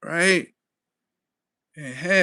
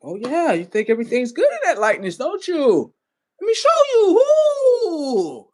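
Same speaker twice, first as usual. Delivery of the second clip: higher and louder, menacing tease building to a whoop; taunting darkness; 10 semitones higher, 6 dB louder than usual. Retold or imitated. Darkness taunting the lightness with a mock whoop. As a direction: gloat like darkness pouncing.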